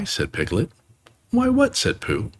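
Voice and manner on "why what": higher puzzled bear. Acted. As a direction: ask it all baffled. Pooh's puzzled question.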